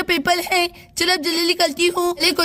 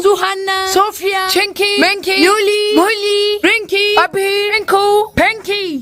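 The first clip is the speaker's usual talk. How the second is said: calling out names; 2 semitones higher, slower than usual. Acted. Teacher to students handing papers, sing-song roll call. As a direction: call the roll across the class.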